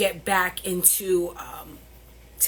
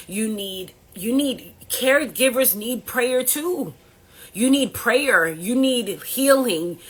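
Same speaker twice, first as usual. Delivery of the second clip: impassioned plea; 5 semitones higher, 6 dB louder, slower than usual. Real, not acted. Emotional plea to caregivers.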